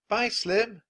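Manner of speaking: high, loud farewell yell. Acted. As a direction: shout after the rider. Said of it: "yelled Annie".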